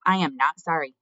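loud, firm defiance; not whispered. A narrator's reading. Despero defying the mouse council, not whispered. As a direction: declare it out loud, firmly. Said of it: "he did not whisper the word".

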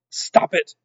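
loud, high, furious order; shouted. A narrator's reading. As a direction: shout it as a rattled villain rat. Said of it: "shouted Roscuro".

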